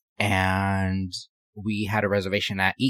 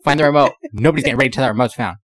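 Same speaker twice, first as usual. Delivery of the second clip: loud, fast bossy demand; bossy shout; 3 semitones higher, 6 dB louder, faster than usual. Retold or imitated. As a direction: bark it like an angry boss. Ike demanding the remote.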